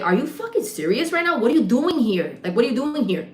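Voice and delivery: high, incredulous demand; mad as hell. Retold or imitated. Bartender retells confronting her drinking cousin, mad as hell. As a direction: fire off outraged questions, starting high. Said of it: "I got mad as hell".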